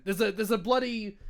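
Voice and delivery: a hint of a British accent; lightly put-on British accent; mild British accent again. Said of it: "I don't know why I'm doing that accent".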